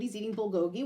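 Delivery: ordinary delivery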